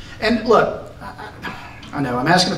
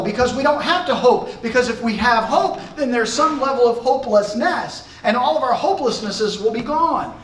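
much higher, urgent sermon climb; fervent preaching; 7 semitones higher than usual. Real, not acted. Preacher to congregation, on faith and hope passing away, fervent and high. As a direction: drive the point home to the pews.

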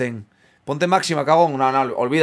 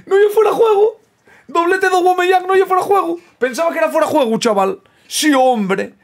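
excited shouting; 8 semitones higher, 6 dB louder than usual. Real, not acted. Celebrating double against league leader.

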